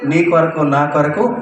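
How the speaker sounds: rising, ringing sermon calls to the crowd; preaching. Acted. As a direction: preach it out to the congregation. (ఉత్సాహంగా బోధించడం)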